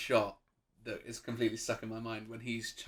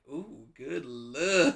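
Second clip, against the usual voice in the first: drawn-out, higher, approving "ooh"; mock admiring; 5 semitones higher, 6 dB louder, much slower than usual. Imagining the actor seeing his bald look, mock admiring. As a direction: savour it slowly, pitched up in pretend admiration.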